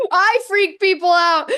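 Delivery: high, loud, proud declaration; gleeful proud shout. Real, not acted. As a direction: belt it out with glee. Repeat after laugh, amped up.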